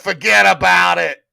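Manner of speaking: loud, high mock New York shout; hammed-up New York accent. Acting out the mocked New York accent.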